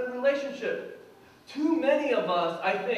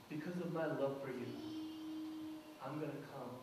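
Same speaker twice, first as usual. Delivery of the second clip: tender reassurance; 5 semitones lower, 12 dB quieter, faster than usual. Retold or imitated. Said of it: "And God says".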